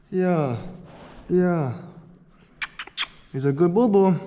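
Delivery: high sing-song dog talk. Real, not acted. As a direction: coo at the puppy. Talking sweetly to his dog.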